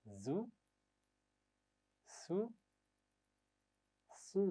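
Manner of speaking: زو and سو said with a clear hiss; whistled sounds. Shown as a demonstration. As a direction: let the hiss run in زو and سو. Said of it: "مخرج کا خیال رکھتے ہوئے میرے بات دہرائیے".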